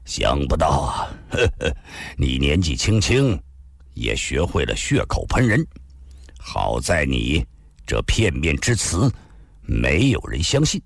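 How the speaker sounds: a hero laughing coldly to dismiss the charge; sneering. Acted. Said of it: "冷笑地说道".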